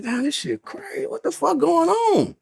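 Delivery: high, slow, drawn-out bewilderment; stunned disbelief. Retold or imitated. To his friend in the holding cell, slow stunned disbelief. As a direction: let the shock stretch every word. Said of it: "I said".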